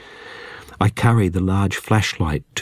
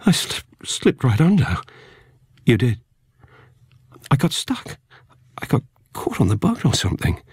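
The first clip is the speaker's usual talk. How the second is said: shaken; 6 semitones higher than usual. Acted. Half-drowned man recovering.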